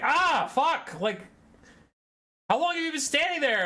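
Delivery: startled high yelp; caught red handed. Retold or imitated. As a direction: jump out of your skin. Startled when grabbed by anxiety.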